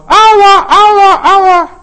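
high, loud repeated echo calls; echo from the forest. Acted. Forest throws the child's cry back, loud high echo. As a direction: imitate a shouted cry ringing back. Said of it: "plötzlich hallt es aus dem Wald raus".

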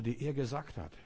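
ordinary talk, nothing marked

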